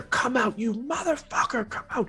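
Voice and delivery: high furious yelling from upstairs; irate screaming. Acted. Enemy at the stairs screams at his betrayer, furious. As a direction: scream it out at the traitor, raging. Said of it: "The other figure you hear ... you screaming irately".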